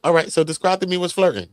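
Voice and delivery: quoting the host. Retold or imitated. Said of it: "She was like".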